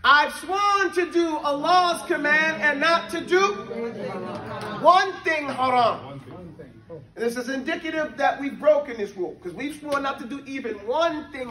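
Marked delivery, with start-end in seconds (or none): 0.0-6.2 slow, raised recitation of the lesson; recited text. Acted. Teacher reciting the book's lines to students, slow and raised. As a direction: declaim it like a set text.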